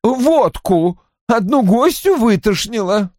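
high-pitched, irritated exclamation; mildly outraged character voice; indignant. A narrator's reading. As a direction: complain testily, pitched well up. Roy's angry complaint continues.

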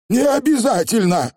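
высоко и твёрдо, по слогам; отчеканить ответ голосом коротышки; чеканно. A narrator's reading. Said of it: "отчеканил он". (чеканный ответ)

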